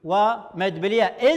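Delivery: normal talking voice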